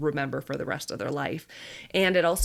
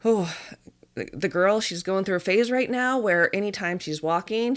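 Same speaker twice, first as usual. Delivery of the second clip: normal talking voice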